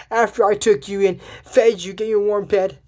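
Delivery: hammed-up reproach; mock scolding. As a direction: scold indignantly, as a retold quote. Acted scolding of the bad skunk.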